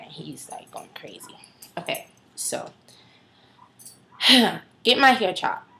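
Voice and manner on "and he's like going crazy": sudden unvoiced whisper. Real, not acted. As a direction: drop to a whisper aside. Dog excited, speaker drops voice.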